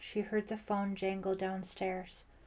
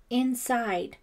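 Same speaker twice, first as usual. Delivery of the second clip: loud, higher, slightly choked word; 4 semitones higher, 7 dB louder than usual. A narrator's reading. Ellie pointing Wilkins to Brian, choked out. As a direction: force the word out. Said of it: "Ellie choked out".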